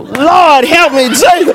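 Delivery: loud, high shouted plea; hammed-up cry to heaven for help; desperate cry. Mother hits the floor praying, desperate cry.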